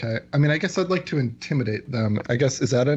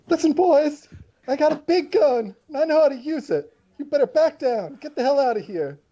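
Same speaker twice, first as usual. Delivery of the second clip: high, loud tough-guy warning; high-pitched menacing bounty hunter voice; intimidating threat; 12 semitones higher, 3 dB louder than usual. Acted. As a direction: lean on them with a big gun. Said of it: "give me your coercion".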